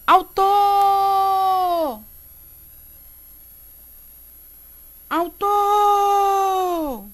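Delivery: high call with "tor" held very long. Shown as a demonstration. Teacher calls the word to find stress, long high "autooor". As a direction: call "autor" like hailing someone far away. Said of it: "Chama a palavra".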